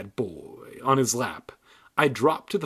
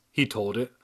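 ordinary delivery, nothing marked

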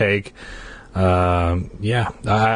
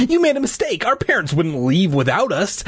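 pleading; 9 semitones higher than usual. Acted. Chasing, protesting.

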